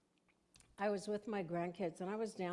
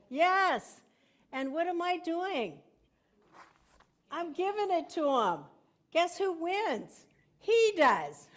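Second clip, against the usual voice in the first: much higher, louder emphatic build; excited preaching; 10 semitones higher, 9 dB louder than usual. Real, not acted. Pastor tells congregation who wins, rising excitedly. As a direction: drive the point home with rising fervour.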